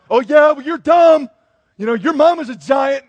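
hammed-up childish heckling; mock schoolyard taunt. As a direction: yell silly insults across the line. Soldiers yelling insults at Philistines.

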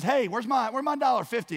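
high, loud, quick confrontation; angry demand. Acted. Forgiven man to his buddy, acted angry demand. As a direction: accost him loudly for the money.

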